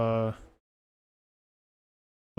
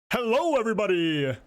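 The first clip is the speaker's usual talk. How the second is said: imitating Markiplier; 5 semitones higher, faster than usual. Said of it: "that's what he says".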